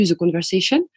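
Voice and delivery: English title with a French accent; English spoken by a French speaker. Real, not acted. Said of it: "mon accent anglais qui est absolument horrible".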